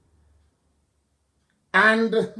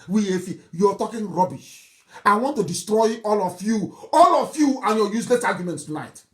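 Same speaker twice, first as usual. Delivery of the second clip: usual speaking voice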